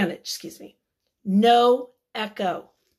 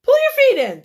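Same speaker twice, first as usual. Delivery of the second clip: much higher, louder bossy hedgehog; Hedgehog instructing; 12 semitones higher, 7 dB louder, much faster than usual. Acted. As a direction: squeak the order like a prim hedgehog.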